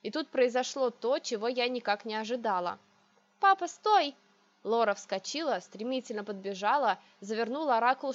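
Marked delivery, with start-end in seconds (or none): 3.4-4.1 high, loud urgent cry; shouting to stop. A narrator's reading. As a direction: yell at him to stop. Sister shouts to stop father. (крик: стой)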